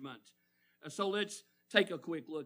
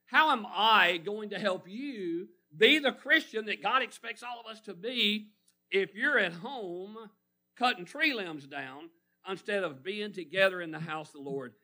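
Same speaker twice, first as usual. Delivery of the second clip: ordinary delivery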